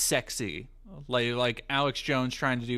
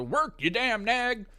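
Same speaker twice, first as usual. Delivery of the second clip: slow, higher cowboy call to a horse; 9 semitones higher, slower than usual. Retold or imitated. Quoting Red Dead, he hollers at his horse like a cowboy. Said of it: "Red Dead Redemption's calls".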